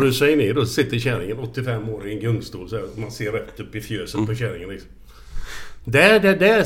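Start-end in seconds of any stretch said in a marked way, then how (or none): 5.8-6.6 put-on excited child. Child pointing at grandmother.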